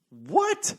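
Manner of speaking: high-pitched incredulous yelp; sharply rising, baffled question; mock disbelief. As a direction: squeak it out like you can't believe it. Retold disbelief at her offer.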